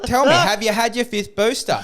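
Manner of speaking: high, loud jeering question; retold heckling at a gym; loud taunt. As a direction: heckle him across the room. Repeating taunt at politician.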